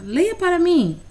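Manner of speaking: mais aguda e mais forte, incentivando; peça com entusiasmo, voz alta; pedido animado de professora. Shown as a demonstration. Professora pede leitura ao aluno. (professora pede ao aluno que leia, aguda e animada)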